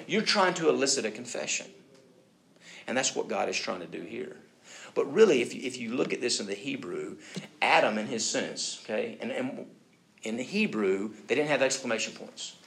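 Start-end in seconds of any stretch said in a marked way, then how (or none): none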